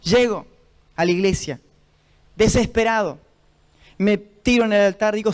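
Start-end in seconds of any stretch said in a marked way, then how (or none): none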